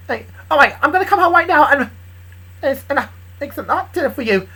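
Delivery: high, eager promise; loud and high, full of eagerness; excited. Acted. Woman promising to come home to a lover, excited.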